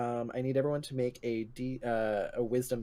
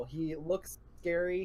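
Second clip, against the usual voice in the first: ordinary talk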